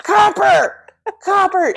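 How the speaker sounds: high-pitched mock yell; retold yelling. Owner yelling for dog at the open gate, high mock yell. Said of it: "I'm yelling for him".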